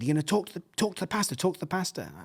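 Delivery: imitated nagging son. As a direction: pester Dad like an eager boy. Son nagging his reluctant dad.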